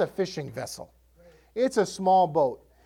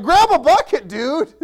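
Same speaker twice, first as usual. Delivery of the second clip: sarcastic quip; 8 semitones higher, 10 dB louder than usual. Retold or imitated. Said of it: "I'm sure they were like".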